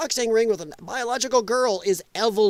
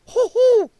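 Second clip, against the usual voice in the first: hooting laugh; 14 semitones higher, 7 dB louder than usual. Real, not acted. Laughing reaction.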